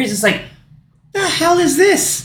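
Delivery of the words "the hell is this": very high, drawn-out mock outrage; mock exasperation. Retelling the officer trying to shoot, high mock exasperation. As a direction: stretch it out high, imitating an incredulous officer. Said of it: "he's so mad".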